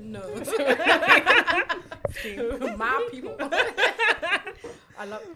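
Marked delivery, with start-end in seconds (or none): 2.8-5.2 laughing mimic.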